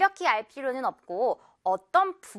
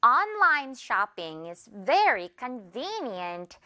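slow, clear model sentence; slow careful English demo; slow example read; much slower than usual. Shown as a demonstration. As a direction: read it out slowly for learners. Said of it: "예문 볼까요?".

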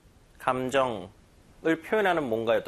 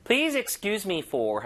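slow model read; 5 semitones higher, much slower than usual. Shown as a demonstration. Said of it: "그래서".

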